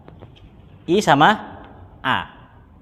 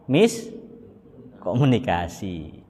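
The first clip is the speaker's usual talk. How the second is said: low, slow and stretched out; drawn out; 8 semitones lower, 6 dB quieter, much slower than usual. Shown as a demonstration. Teacher landing the punchline of the story, lowered and drawn out. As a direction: let the word sag.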